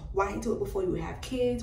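ordinary talk, nothing marked

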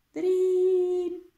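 high, loud imitated bell ring; alarm ring. A narrator's reading. Narrator imitating the hare clock's alarm, high ringing call.

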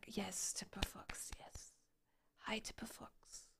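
hushed, soft whisper. Real, not acted. Streamer welcoming a viewer to the stream, whispering. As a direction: keep it to a whisper for the greeting.

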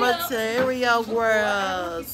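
put-on slow, stretched Material Girls; drawn-out title. Shown as a demonstration. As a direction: draw out Material Girls slowly. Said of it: "I'm gonna say that all night".

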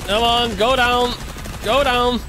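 high, tense urging; will the enemy down. Real, not acted.

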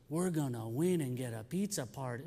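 softly voiced excited second grader; a hint of a small girl's squeal; little girl voice. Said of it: "She goes".

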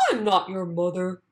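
a dog setting the chick straight; dog's voice. A narrator's reading. Dog tells the baby bird it's not his mother, lightly voiced. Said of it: "said the dog".

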